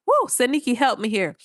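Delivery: usual speaking voice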